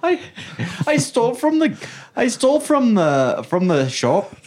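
high Irish lilt, the confession rising like a question; Irish accent. Pretend. Defendant in a mock Irish court, a questioning Irish lilt. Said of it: "like an Irish court".

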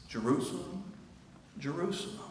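soft, grieving call of the name; a mournful, near-whispered Jerusalem; hushed lament. Acted. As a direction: sigh the name out in sorrow. Hushed lament calling the city.

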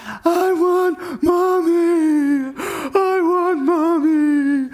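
put-on high, slow, drawn-out child wail; dad imitating his daughters' bath-time wail; mock wailing kids. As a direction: whine it like a tired toddler crying for mom. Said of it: "they unleash their loudest wails".

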